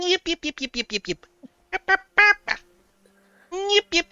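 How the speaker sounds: high yappy little dog barks; yappy chihuahua voice. Pretend. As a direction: yip like a tiny excited chihuahua. Barking like a tiny dog.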